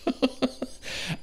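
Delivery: high breathless burst of laughter; real helpless giggle; airless giggle. Real, not acted. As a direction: wheeze out a laugh at the absurdity. Laughing at bird in engine.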